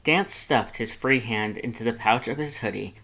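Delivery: ordinary delivery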